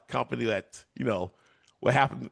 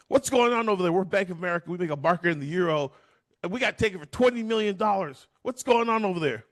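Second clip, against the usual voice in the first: high, indignant complaint; high pitched mock outrage from the bank; angry banker; 7 semitones higher than usual. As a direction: demand answers like an angry banker. Angry bank calls the firm.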